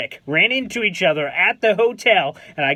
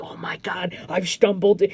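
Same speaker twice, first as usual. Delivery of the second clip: awed whisper. Retold or imitated. Sneaking onto the team floor.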